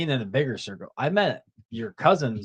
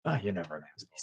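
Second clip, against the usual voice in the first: soft near-whisper into the mic; 7 semitones lower, 10 dB quieter, much faster than usual. Real, not acted. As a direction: say it under your breath. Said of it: "Missus Whisper".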